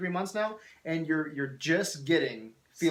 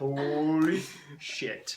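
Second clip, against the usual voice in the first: laughing mimicry; much slower than usual. Host mimicking accent while laughing.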